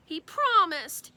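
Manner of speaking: mild tattling whine; insistent child. Acted. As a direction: press the point like a kid. Fudge insisting.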